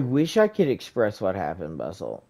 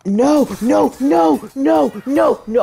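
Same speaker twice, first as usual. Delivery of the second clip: high, loud panicked no's; frantic yelling at the screen; panicked shouting; 12 semitones higher, 8 dB louder than usual. Real, not acted. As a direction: shout no over and over in real panic. Enemy about to kill him.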